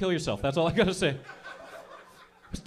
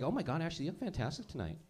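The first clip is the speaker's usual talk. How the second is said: put-on 1 girly friends; 6 dB quieter than usual. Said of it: "She looks at you like".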